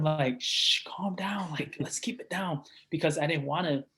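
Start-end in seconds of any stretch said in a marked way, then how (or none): 0.4-2.6 slow, soft whispered shush; hushing whisper. Retold or imitated. Teacher hushing excited students, slow soft whisper. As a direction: hush the kids gently. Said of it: "and I would tell them".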